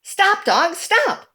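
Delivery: ordinary delivery, nothing marked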